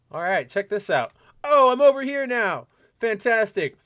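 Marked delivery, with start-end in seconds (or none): 1.4-3.8 high, playfully amazed exclamation; playful amazement. Pretend. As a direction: act delighted at your own camera trick. Shows off moving his camera image.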